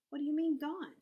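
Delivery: loud, high, shocked question; alarmed outcry. A narrator's reading. Girl learns cat is dead.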